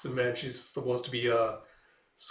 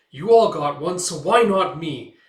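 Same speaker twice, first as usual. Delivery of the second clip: much louder, slower at the end, mock indignant; defiant outburst; 5 semitones higher, 11 dB louder than usual. The witch justifying herself to the group, loud. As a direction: demand it, voice rising.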